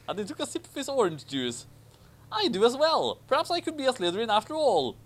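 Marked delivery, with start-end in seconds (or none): 2.3-4.9 much higher, fast, pleased schoolboy; Harry voice. Acted. Harry agreeing with Ron, high and pleased. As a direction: bubble along like a delighted child.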